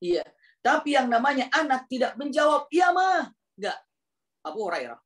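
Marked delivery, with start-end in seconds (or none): 2.7-3.3 high, shouted answer; shouted reply. Shown as a demonstration. Child wrongly shouting back to mother, high yell. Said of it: "ketika berteriak".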